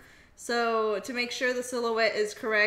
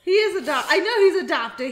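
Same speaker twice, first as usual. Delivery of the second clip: loud, higher-pitched protest through laughter; amused, raised voice breaking into laughs; laughing protest; 5 semitones higher, 9 dB louder than usual. Real, not acted. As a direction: insist loudly while cracking up. Laughing over a teasing remark.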